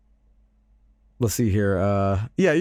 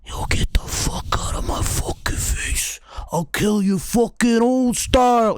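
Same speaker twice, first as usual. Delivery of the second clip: harsh voiceless rasp rising into a high, drawn-out threat; hammed-up strangled rage; furious girlfriend act; 9 semitones higher than usual. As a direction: rasp it like an enraged cartoon heroine. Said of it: "And she goes".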